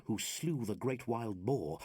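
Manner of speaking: ordinary talk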